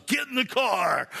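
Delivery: a mother who means business; mom's firm order. Retold or imitated. Mom orders her son into the car, fierce. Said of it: "And my mom goes".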